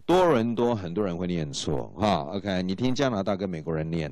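ordinary talk

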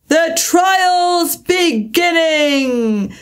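slow, high call from far off; a far-off herald's cry; distant cry. A narrator's reading. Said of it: "was heard in the distance".

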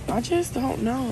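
normal talking voice

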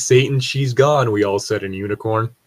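normal talking voice

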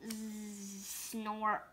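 very soft snoring sounds; sleepy snore. Acted. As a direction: drift off into a snort. Pigeon falls asleep.

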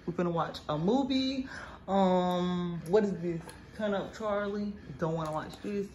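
ordinary delivery

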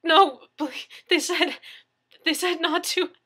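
high, loud, breaking desperate plea; sobbing plea. A narrator's reading. Victim begging neighbor not to report, sobbing. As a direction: plead through panicked tears. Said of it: "Daniel sobbed".

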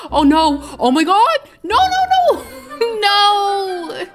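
gasp, then high loud cries of alarm; horrified shrieking. Real, not acted. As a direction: shriek in playful horror as it goes wrong. Watching character fail to reach toilet.

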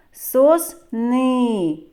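"Сосни" split, with long final "и"; drawn-out final vowel. Shown as a demonstration. Teacher isolating the last vowel, "Сосни" with long "и". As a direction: stretch and repeat the "и" ending "Сосни". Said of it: "І голосний звук".

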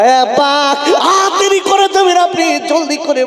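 calling out. Acted. Son calls father to hurry.

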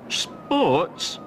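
innocently. Acted. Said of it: "said Cromarty innocently".

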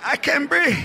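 groaning uncle. Retold or imitated. Uncle on the couch gasping for breath, hammed-up groan. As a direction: moan like you're having a heart attack. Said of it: "So he's all crouched up on the couch".

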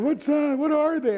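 very high, loud panicky questions; frightened sister. Retold or imitated. Sister asking in panic about the creatures, high and loud. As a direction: squeal it out in fright. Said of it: "and she's like".

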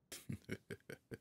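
low-key teasing chuckle; short, quiet string of chuckles; soft chuckle. Acted. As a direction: chuckle softly to yourself. Demon chuckles at disbelieving listener.

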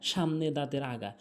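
ordinary talk

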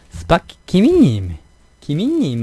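put-on slow, rising Busan-accented name call. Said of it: "막 이러던데". (느리게 올리는 부산 억양 이름 부르기)

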